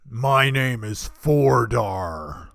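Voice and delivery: slow, loud, heavy half-orc voice; half-orc innkeeper. Acted. Giant half-orc tells the travelers his name, slow and loud. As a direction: boom out each word like a hulking half-orc.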